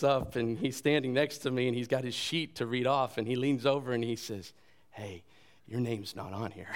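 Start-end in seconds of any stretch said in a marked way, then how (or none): 4.9-6.7 put-on soft, low-key boy's aside; confiding aside. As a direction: lean in and say it quietly, imitating a kid. Boy leans over, confiding to speaker.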